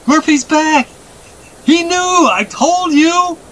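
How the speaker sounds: put-on very high, joyful child's shout; jubilant boy. A narrator's reading. Boy sees his horse return, jubilant. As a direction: crow it in triumph, stretching the end.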